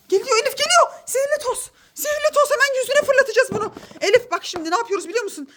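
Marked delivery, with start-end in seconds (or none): 0.1-3.7 excited. Acted. Excited plan with magic dust.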